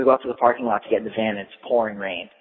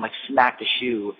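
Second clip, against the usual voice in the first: ordinary talk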